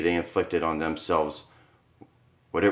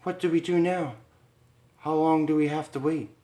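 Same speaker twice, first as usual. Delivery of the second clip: put-on higher, anxious young wife; anxious Lisa; 8 semitones higher than usual. Wife to husband in the dark cell, higher anxious voice.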